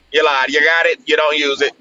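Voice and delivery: normal talking voice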